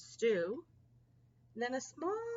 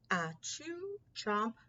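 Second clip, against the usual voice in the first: ordinary delivery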